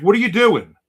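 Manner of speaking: loud, high mock outrage; upset counterman. Counterman confronting Shelly over ketchup, loud mock outrage. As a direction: bark it like an offended hot dog man. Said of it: "He was very upset".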